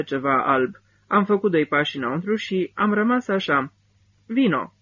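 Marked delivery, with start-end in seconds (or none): 4.3-4.7 higher, lightly voiced woman; a hint of a woman beckoning; woman in the dark inviting the soldier, higher voice; woman's voice. A narrator's reading. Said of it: "m-a îndemnat un glas de femeie".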